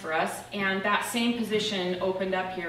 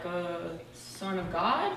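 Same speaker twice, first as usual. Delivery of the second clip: unsure guess; 3 semitones lower, 6 dB quieter, much slower than usual. Retold or imitated. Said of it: "I was like".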